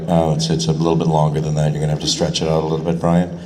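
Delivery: lightly voiced director; director Carl. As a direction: casually tell the actor to stretch it. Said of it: "Carl will be like".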